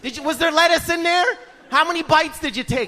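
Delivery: high, relentless questioning; anxious mother. Acted. Mom grilling son on every bite, anxious nagging. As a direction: pile on questions, pitched high. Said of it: "She has anxiety".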